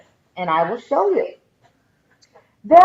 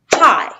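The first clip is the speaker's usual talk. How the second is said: "Hi" as one loud, higher beat; one syllable; 4 semitones higher, 7 dB louder than usual. Shown as a demonstration. Teacher counts syllables for students, "Hi" in one punched beat. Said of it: "one syllable".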